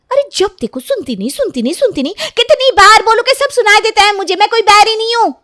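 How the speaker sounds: high, loud rant building to a yell; exasperated shouting. Acted. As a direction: yell back, sick of being nagged. Daughter yells back in quarrel. (तंग आकर ऊँची आवाज़ में चिल्लाना)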